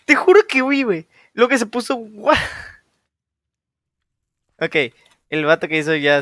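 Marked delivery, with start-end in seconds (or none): 0.0-2.4 high, loud shocked outburst. Real, not acted. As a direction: blurt it out in shock. Reacting to jump scare.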